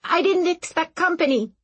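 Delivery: high startled squeak. Acted. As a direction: voice jumps up in a frightened squeak. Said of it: "Aram squeaked".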